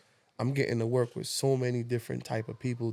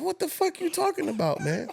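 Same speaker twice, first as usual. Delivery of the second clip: pitched way up in incredulous confusion; what-is-this-guy-on disbelief; laughing mock outrage; baffled disbelief. Retelling his baffled reaction.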